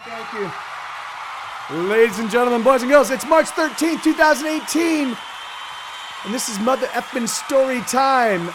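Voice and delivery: big announcer voice, loud and high; booming kids show opener. Acted. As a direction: open the show like a ringmaster.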